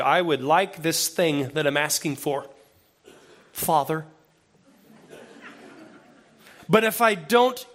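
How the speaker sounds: put-on gentle, calm kid asking politely; content child's request. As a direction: ask nicely, at peace either way. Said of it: "might sound more like this".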